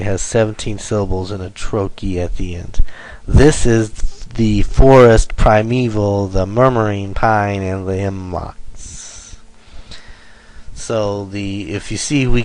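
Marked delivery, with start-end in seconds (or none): 3.3-9.4 strong beat on "This", "for" in "forest", then fading; dactylic stress. Shown as a demonstration. Teacher reading dactylic hexameter example, stressing every third syllable from "This". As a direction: read in threes, starting loud on "This". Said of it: "stressed, unstressed, unstressed".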